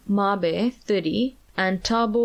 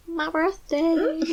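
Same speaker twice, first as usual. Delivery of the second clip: laughing; 9 semitones higher, slower than usual. Real, not acted. Laughing aside about birthday.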